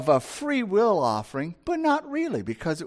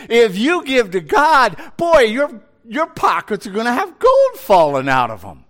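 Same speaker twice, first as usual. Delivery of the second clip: much louder, higher sales-pitch fervour; hyped preacher parody; 5 semitones higher, 8 dB louder than usual. Retold or imitated. Mocking false prosperity teachers promising gold, loud and hyped.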